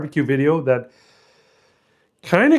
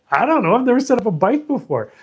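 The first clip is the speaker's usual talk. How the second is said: defensive high protest; 9 semitones higher than usual. Retold or imitated. Defensive reply to brother-in-law.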